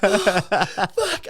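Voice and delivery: high, loud yelp of dread; terrified swearing. Retold or imitated. Skydiver hearing the instructor's rules, a high frightened yelp. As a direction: let the fear burst out. Told not to close eyes.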